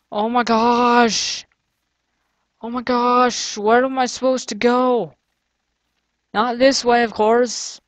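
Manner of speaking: real frustrated whine; exasperated. Real, not acted. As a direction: drag out the exasperation, lost and fed up. Lost in the maze.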